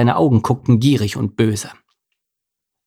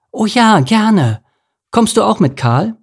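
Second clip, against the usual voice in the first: high, eager little fish; a thrilled young salmon, higher and louder; excited; 11 semitones higher, 5 dB louder than usual. Acted. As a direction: jump at the offer. Lars eager to go.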